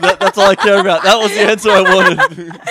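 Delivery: loud, high, excited outburst; triumphant delight. Real, not acted. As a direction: crow loudly over getting the answer you wanted. Delighted at hearing the hoped-for answer.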